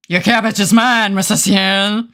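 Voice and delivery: lightly put-on menacing villain; half-hearted mock threat. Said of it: "I don't know why I said it in that voice".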